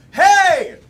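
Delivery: loud, high cue yell. Shown as a demonstration. Host practising the game cue with the audience, loud yell. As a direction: yell it out to set off the crowd. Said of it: "I'm gonna yell".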